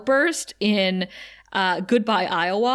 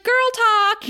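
put-on high, louder gushing girl talk; chirpy Buffy; 13 semitones higher, 5 dB louder than usual. Buffy gushing to Willow about girl talk. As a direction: squeal it like a giddy friend. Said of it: "Buffy's like".

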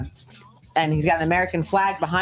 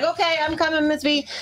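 high, eager mock reply; hammed-up eager "I'm coming"; eager employee; 13 semitones higher than usual. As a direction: hurry to answer like a devoted worker. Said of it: "he'd be like".

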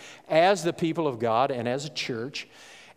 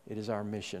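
dropped to a much softer "It is our mission"; 5 semitones lower, 10 dB quieter, faster than usual. Real, not acted. Pastor repeating the point to the church, much softer. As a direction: let it fall away, much softer. Restated softly after emphatic take.

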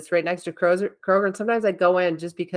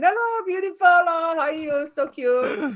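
very high singsong mock greeting; over-the-top cooing shop lady, high-pitched; gushing store-clerk imitation; 10 semitones higher than usual. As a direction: gush at a customer in a high put-on accent. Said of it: "she's got this accent".

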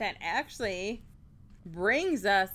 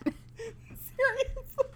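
after laughing hard; 13 semitones higher, 3 dB quieter, much slower than usual. Real, not acted. Still laughing after fit.

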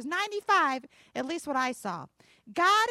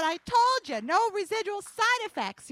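higher, louder vindication; triumphant exclamation; 5 semitones higher, 5 dB louder than usual. Retold or imitated. Mother at the mirror proving her point, triumphant exclamation. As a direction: crow it in triumph, punching "told". Retold triumph, repeated with force.

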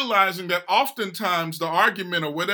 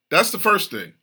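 ordinary talk

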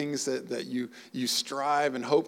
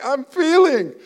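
mock crying; 12 semitones higher, 11 dB louder, slower than usual. Imitating overcome crier.

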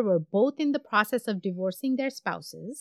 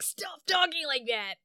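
mock-angry dad, higher and softer; put-on parental scolding, pitched up; imitating mad dad; 5 semitones higher, 8 dB quieter than usual. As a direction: scold like an exasperated father, voice held back. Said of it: "would be so mad".

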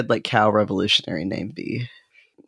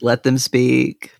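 slow solemn; 3 semitones higher, much slower than usual. Pretend. Repeats the call, louder.

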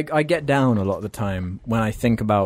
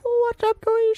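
screaming, very high-pitched intro impression; hammed-up high screamed greeting; Jacksepticeye; much slower than usual. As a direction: shriek a mock YouTuber intro way up high. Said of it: "he's got such a high-pitched voice".

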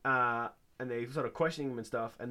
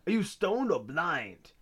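slow, louder mock scolding; rude beat cop; 5 semitones higher, 3 dB louder, slower than usual. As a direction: stretch out the insult like a fed-up cop. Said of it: "rude to him".

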